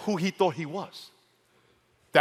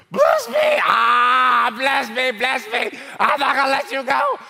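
much higher, loud, sobbing cry to be blessed; desperate crying plea; 9 semitones higher, 7 dB louder, slower than usual. Acted. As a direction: beg through tears at full voice. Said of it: "Here's Jacob".

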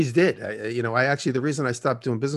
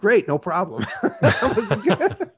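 louder, laughing fake cheer; mock cheerful laughing; 6 semitones higher, 6 dB louder, slower than usual. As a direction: chirp it, then laugh at yourself. Fake cheerful text reply.

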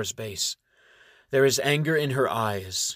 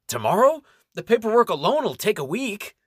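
high, loud rising tomorrow; startled protest; 10 semitones higher, 5 dB louder than usual. Acted. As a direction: squeak out the objection. Nganyon protests the deadline.

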